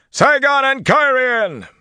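drawn-out, high, loud battle cry. A narrator's reading. Bertholm rallying his men as he rides, shouting. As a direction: yell it slowly and at full pitch. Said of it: "Bertholm raised his own voice".

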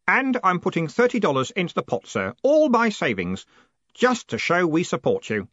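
high, keen loyal clerk; eager Stanley, earnest. Acted. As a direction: announce it with earnest pride.

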